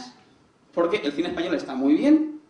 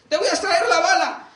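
high, loud mock-dramatic doctor; hammed-up film doctor taking charge; urgent announcement; 12 semitones higher, 5 dB louder than usual. Doctor with a machete tells the wounded man, urgent high shout.

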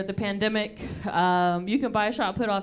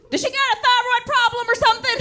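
much higher, fast mocking mom voice; mock shrill mom; 12 semitones higher, 3 dB louder, much faster than usual. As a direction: squeak it out like an outraged mom. Mom asks the doctor.

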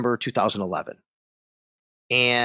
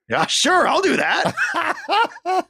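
high, drawn-out mock eagerness; imitating a gleefully willing stranger, pitch soaring; eager agreement; much slower than usual. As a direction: jump at the offer, breaking into a laugh. Acts wrong guy eagerly agreeing.

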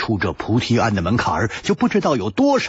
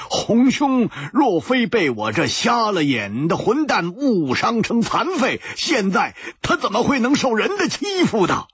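higher, urgent self-blame; loud outburst; 13 semitones higher than usual. A narrator's reading. Hu Tiehua blaming himself before his friends, loud and high. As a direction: jump up and blurt it, voice pitched up. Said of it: "跳起来大声地说".

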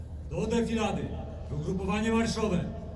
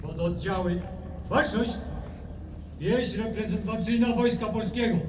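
drill sergeant; 8 semitones higher, 3 dB quieter than usual. Real, not acted. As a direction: call it across the parade ground. Military parade, commander's commands. (musztrowa komenda)

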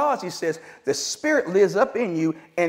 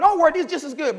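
mock Mama; 8 semitones higher, 4 dB louder, faster than usual. Mama reassuring.

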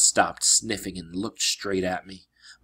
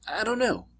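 higher, tense reply; 11 semitones higher than usual. Acted. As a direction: answer tightly, sensing danger. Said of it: "he said tensely".

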